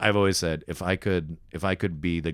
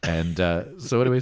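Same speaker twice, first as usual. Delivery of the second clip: ordinary delivery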